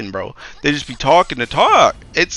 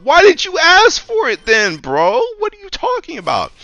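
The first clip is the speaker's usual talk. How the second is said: much higher, outraged disbelief; incredulous; 3 dB louder than usual. Real, not acted. IT guy to a coworker who never swapped laptops, incredulous and much higher. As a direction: squeak out the disbelief.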